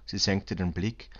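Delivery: plain reading voice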